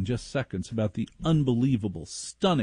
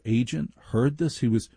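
ordinary delivery

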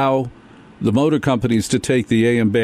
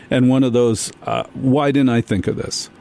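ordinary talk, nothing marked

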